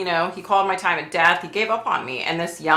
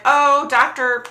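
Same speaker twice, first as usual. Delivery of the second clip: higher, slow, louder sing-song of a nurse; sweet nurse voice; 8 semitones higher, 4 dB louder, slower than usual. Retold or imitated. Nurse tells the patient about the doctor, in a higher sing-song voice. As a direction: coo it like a chirpy nurse.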